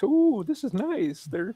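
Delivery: high, slow, delighted voice; mock delighted guy. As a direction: coo it like a pleased dupe. Voices victim enjoying flight.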